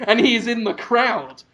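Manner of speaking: ordinary talk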